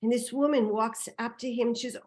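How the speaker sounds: ordinary delivery, nothing marked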